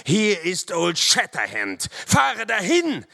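high, loud challenge, rising in force; fierce shout. A narrator's reading. Narrator to the terrified warrior, fierce high shout. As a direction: announce yourself and attack with a shout. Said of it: "rief ich".

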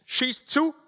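high, forceful dare; defiant lieutenant. A narrator's reading. Lieutenant dares captors to shoot, defiant.

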